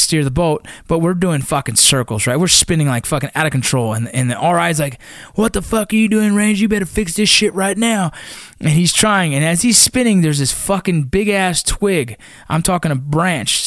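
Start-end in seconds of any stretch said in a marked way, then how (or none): 5.4-8.1 imitated scolding, raised pitch; angry instructor. Instructor to ranger spinning the boat, imitated angry scolding. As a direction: berate them like an instructor, without full volume.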